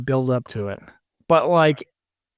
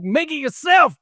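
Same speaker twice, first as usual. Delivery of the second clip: higher, offhand brush-off; a sarcastic customer brushing off a complaint, higher and offhand; mock dismissive retort; 11 semitones higher than usual. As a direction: toss it off like it's obvious. Mock customer retort about pitas.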